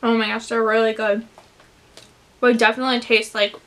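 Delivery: talking between bites; chewing while talking. Real, not acted. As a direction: mouth partly full. Seen 1: hand to mouth before; lips pursed, cheek working mid-speech.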